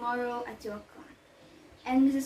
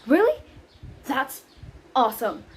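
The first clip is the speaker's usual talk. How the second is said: awesome said louder with a falling finish; excited; 6 dB louder than usual. Shown as a demonstration. Nanny cheering Romeo's plan, a loud happy outburst. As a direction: let it burst out happily. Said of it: "I mean".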